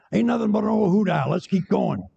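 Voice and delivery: high-pitched dismissive sheriff. Acted. Sheriff to his search party, high dismissive call. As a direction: wave it off as a joke-story sheriff. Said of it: "Sheriff says".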